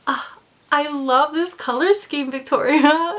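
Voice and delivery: high, bright gush rising on the name; gushing compliment. Retold or imitated. Cashier gushing to Victoria about her colour scheme, high.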